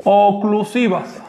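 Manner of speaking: "Oclusivas" spelt out slowly, falling; slow announcement. Shown as a demonstration. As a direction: draw out Oclusivas like a heading. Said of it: "que son de los siguientes tipos". (Oclusivas, lento y marcado como título)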